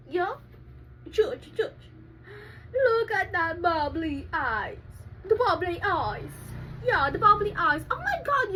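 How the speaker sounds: normal talking voice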